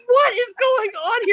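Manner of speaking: high, loud, baffled question; shocked high quote. Retold or imitated. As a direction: squeal in disbelief. Acting her reaction.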